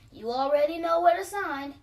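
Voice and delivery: deadpan the routine; officer handing dad the papers again; weary officer. A narrator's reading.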